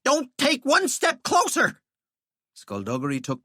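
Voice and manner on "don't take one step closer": high, slow frantic yell; panicked yell. Acted. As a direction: yell in terror. Terrified man facing skeleton.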